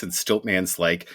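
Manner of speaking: normal talking voice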